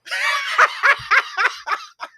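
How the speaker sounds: loud high burst of laughter. Real, not acted. Laughing at the interview clip.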